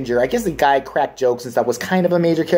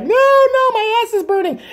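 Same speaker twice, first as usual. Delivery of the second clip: put-on much higher, louder shrieking child; screaming kid; 3 dB louder than usual. The punished son crying out on the hot radiator, shrieking.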